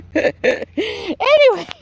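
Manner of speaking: long, high, stretched "Anyway"; drawn-out transition. Real, not acted. Gardener moving on after laughing, drawn-out and high.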